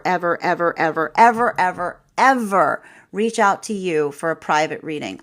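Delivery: stacked ever, ever, ever hammered one after another; emphatic never ever. Real, not acted. Warning viewers she never reaches out, emphatic. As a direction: keep piling on the evers to drive it home.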